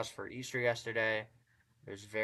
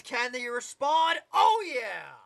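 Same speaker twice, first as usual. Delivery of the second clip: higher, much louder yelling at the big shot; excited shout; 11 semitones higher, 9 dB louder, slower than usual. Real, not acted. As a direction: erupt as the three drops. Big three-pointer falls.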